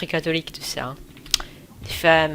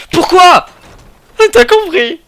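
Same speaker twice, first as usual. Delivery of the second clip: mock indignation; 13 semitones higher, 16 dB louder than usual. Teasing viewers.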